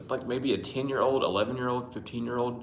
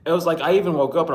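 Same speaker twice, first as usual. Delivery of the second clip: excited rush; 8 semitones higher, 9 dB louder, much faster than usual. Real, not acted. Excited rush while greeting viewers.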